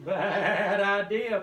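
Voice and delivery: slow, loud bleating sheep voice. Acted. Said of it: "bleated the sheep".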